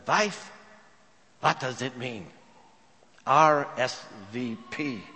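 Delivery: put-on husband puzzling slowly in Eastern European accent; thick Eastern European accent. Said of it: "in his thick Eastern European accent".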